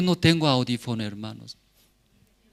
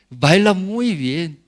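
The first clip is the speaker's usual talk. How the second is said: praise; 5 semitones higher, 6 dB louder, slower than usual. Retold or imitated. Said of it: "el jovencito sale".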